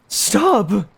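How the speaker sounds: put-on high, loud boy's shout; crying out. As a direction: yell to stop the kick. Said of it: "The young boy cried".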